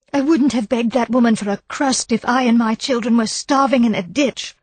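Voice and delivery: high, agitated, indignant outburst. A narrator's reading. Wife outraged at the idea of begging her rival. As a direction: spit out your contempt for the other woman. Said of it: "agitated turn about the room".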